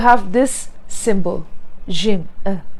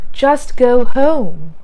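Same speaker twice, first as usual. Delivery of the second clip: "Just go home" very slow and loud, "home" drawn out; a stretched, emphatic "Just go home"; 2 semitones higher, 6 dB louder, much slower than usual. Shown as a demonstration. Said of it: "You don't have to always say".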